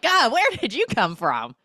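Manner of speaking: loud, high startled yelp; exaggerated shocked outburst; mock startled. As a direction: act out being jumped by a stranger. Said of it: "Everyone turns like".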